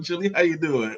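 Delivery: ordinary talk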